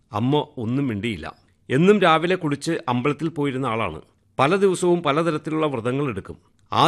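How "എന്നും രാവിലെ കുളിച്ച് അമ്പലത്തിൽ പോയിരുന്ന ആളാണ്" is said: ordinary delivery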